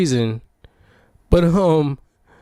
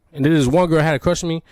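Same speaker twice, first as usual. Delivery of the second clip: usual speaking voice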